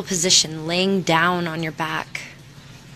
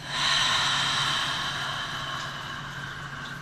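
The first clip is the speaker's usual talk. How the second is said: long, soft open-mouth sigh; audible exhale fading away; 8 dB quieter than usual. Shown as a demonstration. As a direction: let the breath drain slowly out.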